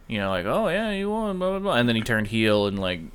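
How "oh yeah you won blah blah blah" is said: high, sing-song mock congratulations; mock fake praise. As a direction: squeak it out like an insincere winner. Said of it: "So then he was like".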